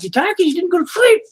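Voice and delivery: exasperated retort. Retold or imitated. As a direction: snap back at her, hammed-up. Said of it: "I'm like".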